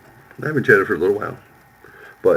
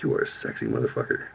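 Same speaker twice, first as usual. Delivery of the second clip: whispered flirt; 10 semitones lower, 6 dB quieter than usual. Retold or imitated. Quoting her flirty line.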